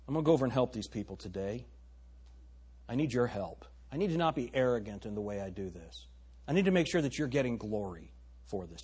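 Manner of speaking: soft, low humble prayer. Shown as a demonstration. Showing the congregation how to pray, softly. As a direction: pray it quietly to God. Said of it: "How would you do that in faith?".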